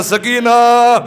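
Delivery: slow, drawn-out wailing phrases; anguished lament. Acted. Father warns Sakina of tomorrow's suffering, slow anguished lament. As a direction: grieve aloud, stretching each line's end. Husain's grieving words to his daughter.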